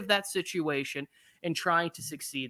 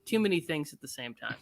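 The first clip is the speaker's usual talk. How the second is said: ordinary talk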